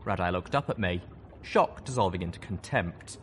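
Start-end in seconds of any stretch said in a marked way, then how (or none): none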